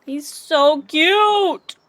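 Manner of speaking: slow, loud, high cooing; gushing. Real, not acted. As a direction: swoon over the cute pet. Gushing over a cute pet.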